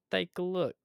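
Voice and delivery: a hint of Italian in a masked robber's voice; light Italian accent. Masked robber threatening Evan, in a light Italian accent. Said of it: "spoke with a light Italian accent".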